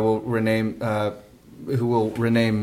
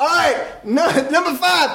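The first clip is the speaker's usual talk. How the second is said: high emcee holler; hype man. Retold or imitated. Emcee calling the next in line, hyped. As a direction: move the show along with a shout. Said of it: "And he goes".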